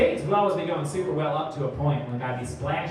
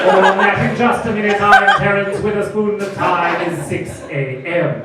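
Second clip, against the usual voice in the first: mock radio host; 2 semitones higher, 9 dB louder than usual.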